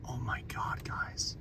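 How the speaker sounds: hushed, breathless whisper. Real, not acted. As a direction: whisper it in awe. Awed whisper at the storm.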